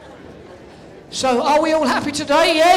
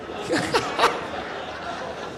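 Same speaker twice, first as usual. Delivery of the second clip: laughing; 7 semitones higher, 9 dB quieter than usual. Real, not acted. Host laughs at audience member.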